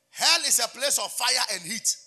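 slow, loud, driving declaration; preaching at full force. Acted. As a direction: proclaim it like a warning. Repeats the point louder.

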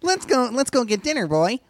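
put-on high kid calling a dog; eager kid. Kid invites dog to dinner, eager. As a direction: chirp it to your new pet.

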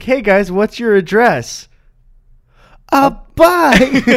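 high, slow host voice, then a loud high guess; hammed-up cartoon host asking the audience; mock kids-show host. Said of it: "for the audience, just like".

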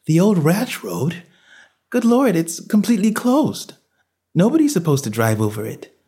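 high-pitched, incredulous woman's objection; alarmed wife. Acted. Wife objects that the old road is closed, alarmed. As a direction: voice a startled wife, pitched well up.

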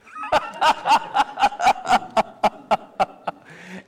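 real laughter. Real, not acted. Congregation laughs.

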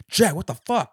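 high, loud mock outburst; imitating an exasperated yell; angry yell. Retelling the driver yelling at Jack again. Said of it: "he's like".

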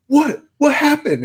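put-on high alarmed girlfriend voice. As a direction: call from the other room, worried and high. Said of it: "She's like".

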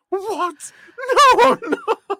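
high, laughing through the words; mock-indignant denial broken by laughter. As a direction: squeak it out while cracking up. Retold Father Ted denial, laughing.

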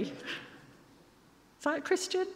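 mock self-reproach.

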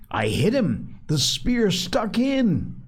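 excited boast. Acted.

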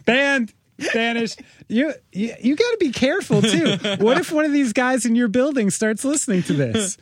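high, fast, laughing tease; laughing teasing. Real, not acted.

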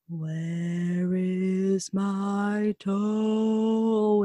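slow drawn-out moaning voice; groaning ghost. Acted. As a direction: moan it very slowly, like a ghost. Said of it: "it groaned".

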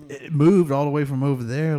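acting out spooked disbelief; retold alarmed protest. Retold protest against going to look.

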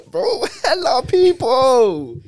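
high cracking laugh; wheezing with laughter. Real, not acted. Laughing at the thought.

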